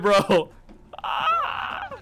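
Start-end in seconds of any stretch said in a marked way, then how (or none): 1.0-1.9 high, thin startled "ah"; high scream. Real, not acted. Player startled by the monster, high scream. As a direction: let out a high yelp of fright. Startled cry in horror game.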